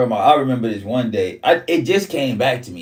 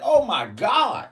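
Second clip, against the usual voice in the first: slow, high drawn-out gasp of words; aghast; 10 semitones higher, much slower than usual. Retold or imitated. Staring at the bruises, a slow high stunned groan. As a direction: stretch it out, stunned.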